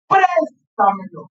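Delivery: "Préstamelo" said slowly by syllables; teacher dividing "Préstamelo" into syllables; slow syllable split. Shown as a demonstration. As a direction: spell out "Préstamelo" slowly for the class. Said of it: "¿Cómo dividimos silábicamente la palabra préstamelo?".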